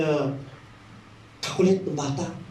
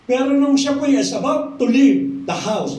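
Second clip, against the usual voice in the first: ordinary talk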